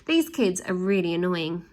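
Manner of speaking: usual speaking voice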